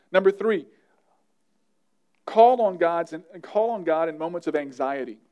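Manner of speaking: ordinary delivery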